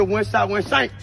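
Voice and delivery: normal talking voice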